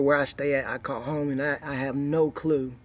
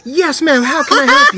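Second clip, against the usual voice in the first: bright, much higher phone-agent voice; sweet, polished customer service voice, much higher and louder; 12 semitones higher, 11 dB louder than usual. Retold or imitated. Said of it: "Customer service voice".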